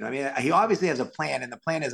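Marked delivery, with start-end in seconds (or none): none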